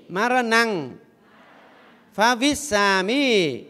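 tụng chậm từng âm Pali; xướng từng chữ cho đại chúng theo. Shown as a demonstration. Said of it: "đọc theo thầy". (giọng xướng tụng chậm)

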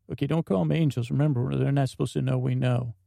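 ordinary talk, nothing marked

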